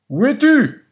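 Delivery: loud, high-pitched puzzled call. A narrator's reading. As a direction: call out to a voice you cannot place. Puzzled master calls to hidden voice.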